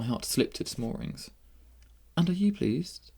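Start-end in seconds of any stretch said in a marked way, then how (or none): 2.2-3.1 put-on young princess. A narrator's reading. Princess asking the winner how he feels, high. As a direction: ask him in a high princess voice.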